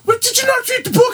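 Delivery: very high, squawking officer voice; exasperated welfare officer. Acted. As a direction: shriek it as a scolding welfare officer. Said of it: "Wait, what accent's that?".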